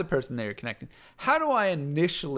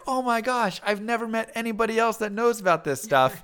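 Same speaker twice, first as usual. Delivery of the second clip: higher, delighted gush of recognition; thrilled surprise; 7 semitones higher than usual. Retold or imitated. A stranger thrilled to meet a fellow fan, excited. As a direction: squeal it like you've found your people. New friend thrilled by shared interest.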